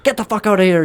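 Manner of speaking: high, dismissive snap; mock angry shopkeeper. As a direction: throw him out of the shop. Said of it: "shopkeeper replies".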